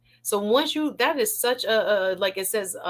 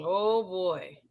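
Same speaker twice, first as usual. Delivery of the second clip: ordinary talk, nothing marked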